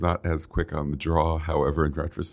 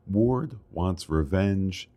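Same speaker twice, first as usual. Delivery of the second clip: ordinary talk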